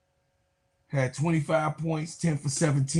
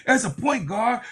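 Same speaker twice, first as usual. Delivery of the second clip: ordinary talk, nothing marked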